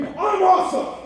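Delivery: high, loud triumphant I'm awesome; magic words shout. Shown as a demonstration. Performer casting a spell before the crowd, loud shout. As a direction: proclaim it as a spell. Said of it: "the most optimistic magic words".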